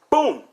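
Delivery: loud, high "boom"; explosive impact noise; imitated punch impact. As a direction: punch out the boom as a sound effect.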